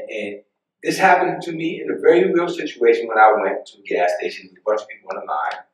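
ordinary delivery

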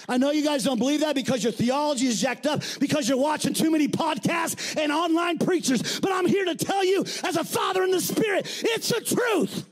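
preaching climax. Acted. Father in the spirit preaching to the church, building to a shout. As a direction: drive it up to the truth.

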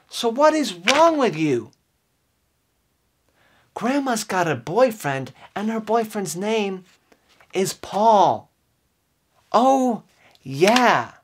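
ordinary talk, nothing marked

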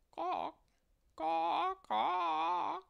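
very high, loud imitated hen squawks; hen clucking. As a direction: squawk like a hen three times. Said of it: "clucked the hen".

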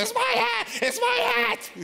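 put-on shrill, very high child's scream; screaming kid. Child fighting over the yellow hat, shrill scream. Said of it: "the screaming starts".

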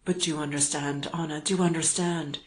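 ordinary delivery, nothing marked

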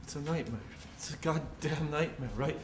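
quiet, hopeless mutter; despairing mutter. Acted. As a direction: murmur it to yourself in despair. Prisoner despairs under his breath.

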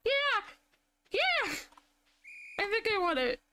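high, rising panic over a timed contest; frantic high squeaks, oh my god; panicking. Real, not acted. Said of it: "I'm kinda panicking now".

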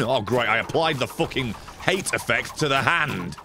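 ordinary talk, nothing marked

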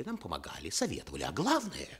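ordinary delivery

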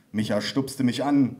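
ordinary delivery, nothing marked

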